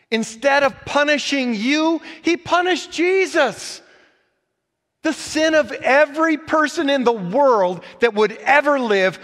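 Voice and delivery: loud, high, soaring sermon climax; full-force preaching. Acted. Pastor preaching the sin laid on Christ, soaring full-force preaching. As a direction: lift it up to a passionate peak.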